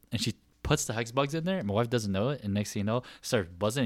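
ordinary talk, nothing marked

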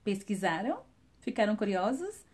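usual speaking voice

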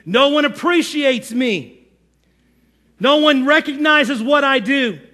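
high, aggrieved victim complaint; mock martyr. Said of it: "is the martyr or the victim".

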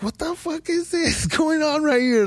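high, laughing disbelief at the sight; half-laughing what is this; shocked disbelief. Retold or imitated. To a friend about naked people at Pride, shocked laughing disbelief. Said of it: "I was like".

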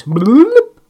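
loud, high, silly bloop; goofy rising bloop sound effect; funny bloop noise. Shown as a demonstration. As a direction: throw in a cartoonish bloop for laughs. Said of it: "put in some extra, uh, funny sound".

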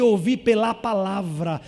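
ordinary talk

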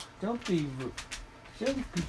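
playful scolding coo. Real, not acted. Mock scolding the dog.

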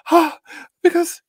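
tearfully explaining through emotion; shy tearful fan. Retold or imitated. Shy crying fan telling John why she is crying, teary. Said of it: "She's like".

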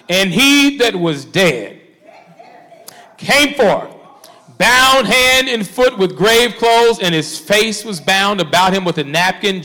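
slow, forceful proclamation with big pauses; dramatic scripture preaching. Acted. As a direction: draw out the miracle for the congregation.

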